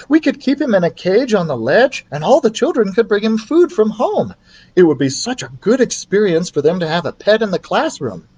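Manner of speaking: agreeing teacher. Acted. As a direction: chime in brightly. Betty's teacher voice.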